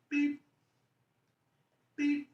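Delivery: thin high electronic beep; monitor beep impression. Shown as a demonstration. Imitating intensive care, high mechanical beep. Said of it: "do my impression of it".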